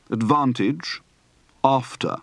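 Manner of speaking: "Advantage" and "after" with long ah; open-throated ah in "Advantage"; broad open-throated A. Shown as a demonstration. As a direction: say "after" as AHF-tuh. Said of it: "Practice for the broad, open-throated A".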